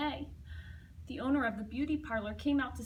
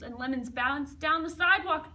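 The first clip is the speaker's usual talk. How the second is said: ordinary delivery, nothing marked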